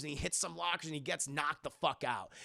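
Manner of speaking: normal talking voice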